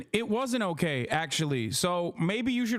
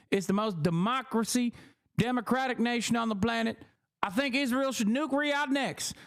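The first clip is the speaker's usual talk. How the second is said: hammed-up South Carolina politician; mock tirade as a zealous senator; put-on South Carolina accent, mock-fervent; hawkish politician impression; 5 semitones higher than usual.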